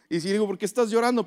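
mock exasperated question. Retells asking crying wife why.

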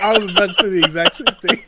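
talks fast through laughter; laughing. Real, not acted. Agreeing with his co-host, laughing. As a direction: blurt it out while cracking up.

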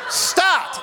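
sudden high-pitched command; commanding prayer cry. Retold or imitated. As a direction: call it out like a miracle command. Retold command prayer over engine.

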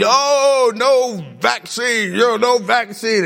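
hammed-up slow complaint; mock protester. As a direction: parody the anti-vaccine crowd.

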